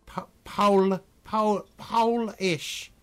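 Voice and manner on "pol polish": slow "Polish" with long "Po" like "poh-lish"; sounded-out Polish. Shown as a demonstration. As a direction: drag out "Polish" as "Pooh-lish". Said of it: "surely they say".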